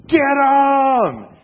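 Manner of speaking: high, loud shouted order to charge; imitated soldiers yelling attack; battle shout. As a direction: yell it as you charge. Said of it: "they were probably like".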